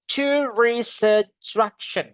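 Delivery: tourist attraction linked smoothly, slow; linked pronunciation. Shown as a demonstration. Teacher showing students how the words join. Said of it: "Digabungin tourist attraction".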